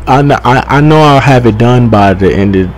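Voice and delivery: ordinary talk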